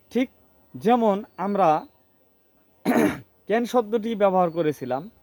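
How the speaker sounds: normal talking voice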